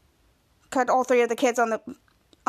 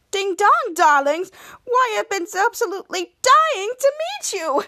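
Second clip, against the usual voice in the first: put-on higher, gushing old-time diva ghost; theatrical ghost; 5 semitones higher than usual. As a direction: sweep in like a grand dame spirit. Ghost greets the kids theatrically.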